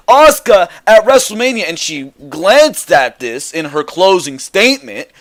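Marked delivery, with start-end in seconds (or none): 0.1-1.6 high, loud wrestling promo; intense promo call. Shown as a demonstration. Host showing how the promo should sound, high and loud. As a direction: punch it up like a fired-up challenger.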